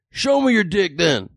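high, slow mock demand; worked-up heckler calling the bluff; riled heckler. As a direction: mimic a heckler who has been set off. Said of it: "this set him off".

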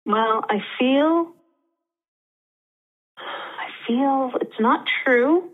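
slow, halting, welling up; feeling the hurt as she admits it; sad. Real, not acted. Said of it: "but I feel sad too".

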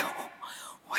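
soft whispered sigh. Retold or imitated. Driver pitying the angry woman, whispered. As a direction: breathe it without voice. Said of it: "Again, I'm like".

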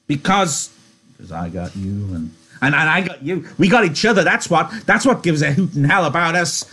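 high, loud triumphant cry. Acted. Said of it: "Lenny cried in triumph".

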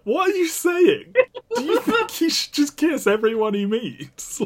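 high-pitched, laughing incredulous challenge; mock disbelief. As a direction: question it in a high, baffled voice. Mock disbelief at film line.

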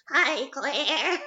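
high, wobbling silly voice. Acted. Greeting a friend to annoy them, high song voice. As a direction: wobble it up high like the song's hook. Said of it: "If you would talk in that voice".

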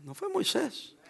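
quiet falling "fue"; hushed repeat. Shown as a demonstration. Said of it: "Voy a volver a decirlo".

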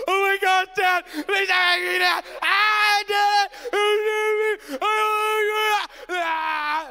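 imitating a hysterical, high screaming wail; hammed-up panicked bawling, loud and drawn out; tearful meltdown. Dad imitating daughter panicking before going on air, tearful meltdown. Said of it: "she turns into tears".

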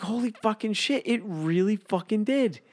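the penny drops; realizing the old job really scarred him; stunned realization. Retold or imitated. Retelling his stunned realization.

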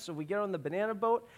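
ordinary delivery

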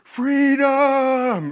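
high drawn-out rallying cry of freedom; Braveheart battle cry. Retold or imitated. Preacher recalling the film's hero, high rallying cry. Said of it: "I think of the movie Braveheart".

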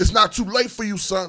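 high, shouted encouragement; imitating an impassioned stranger; over-invested stranger. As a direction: cry it out with full conviction. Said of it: "he was so invested".

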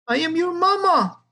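high squawk of a hen, falling on mama; pitched well up, hen squawking I am your mama. A narrator's reading. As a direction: squawk it like a frantic mother hen. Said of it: "the hen squawked".

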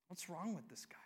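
breathy stage whisper to the crowd; hushed, incredulous aside. Real, not acted. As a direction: lean in and whisper it. Aside after others' outrage.

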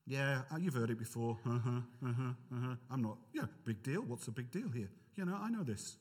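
low, bored dismissal; unimpressed. Acted. Preacher voices a listener who knows it all, unimpressed. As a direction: shrug it off as old news.

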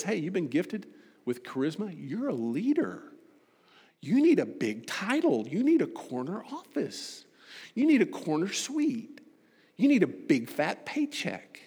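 voice of the world. Retold or imitated. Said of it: "The world says".

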